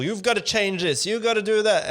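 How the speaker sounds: hammed-up motivational yelling; mock preachy shout. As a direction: belt it out like a preacher lecturing a crowd. Speakers preaching at their audiences.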